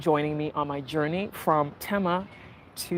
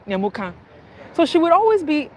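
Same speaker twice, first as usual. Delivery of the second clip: ordinary talk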